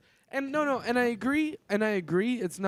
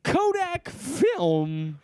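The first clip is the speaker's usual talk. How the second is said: Cosby impression; 3 semitones higher, much slower than usual. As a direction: stretch it out like Cosby. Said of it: "Do your Cosby impression".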